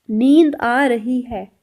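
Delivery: slow, careful Hindi phrase; slow Hindi demo. Shown as a demonstration. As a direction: say it slowly for learners. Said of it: "Hindi में बोलते हैं नींद". (धीमे-धीमे हिंदी वाक्य)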